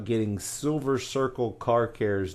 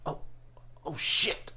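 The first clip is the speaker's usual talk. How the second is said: muttered curse; 6 semitones higher, 7 dB quieter than usual. Retold or imitated. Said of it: "but he lets out".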